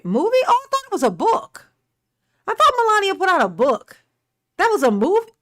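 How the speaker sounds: high, loud, astonished questions; can't believe it was a movie; shocked disbelief. Real, not acted. Reacting to a news headline in loud high shock.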